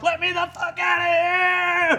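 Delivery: high panicked shout; panicked yell. Acted. Barista banging on the door yells to get out, high and panicked. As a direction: scream to be let out.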